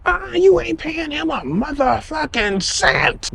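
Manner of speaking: high, slow mock fury; angry woman voice. Date threatening the waiter, high and slow, mock furious. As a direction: draw it out high, dripping with menace. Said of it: "Now she loud, she angry".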